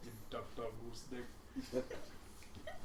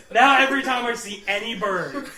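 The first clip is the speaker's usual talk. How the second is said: ordinary delivery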